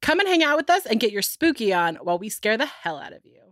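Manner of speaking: usual speaking voice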